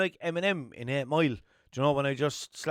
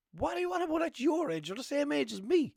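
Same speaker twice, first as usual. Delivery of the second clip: high-pitched indignant challenge to a friend; exasperated, much higher than usual; incredulous retort; 11 semitones higher than usual. Retold or imitated. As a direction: throw it back in disbelief, voice raised in pitch. Challenging a friend's age excuse.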